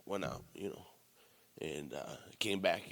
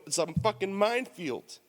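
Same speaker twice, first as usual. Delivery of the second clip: ordinary talk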